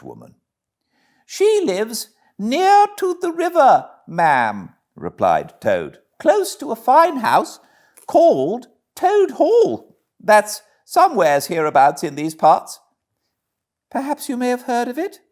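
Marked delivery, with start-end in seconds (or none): 1.3-4.8 very high, loud falsetto of a put-upon washerwoman; Toad as fussing washerwoman. Acted. 6.2-15.2 very high, loud falsetto of a put-upon washerwoman; Toad as fussing washerwoman. Acted.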